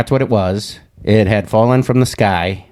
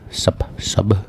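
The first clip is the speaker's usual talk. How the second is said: usual speaking voice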